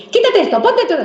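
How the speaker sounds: high-pitched scolding order; harsh command. Acted. Parent constantly ordering a child about, harsh high command. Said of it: "es realmente un maltrato".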